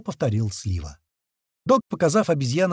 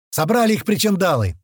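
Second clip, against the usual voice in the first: barked order; 6 semitones higher, 4 dB louder than usual. A narrator's reading. Leader ordering men to load up.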